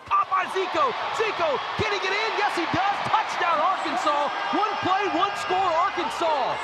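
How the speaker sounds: high, loud touchdown call; excited shout. Real, not acted. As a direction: yell the score as it happens. Touchdown call, play-by-play climax.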